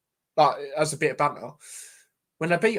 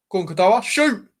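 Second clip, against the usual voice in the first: rising shout of Shoot, higher and louder; urging a shot; 6 semitones higher, 6 dB louder than usual. Real, not acted. Watching the match, urging Katoa to shoot, excited shout. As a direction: yell at the screen for him to shoot.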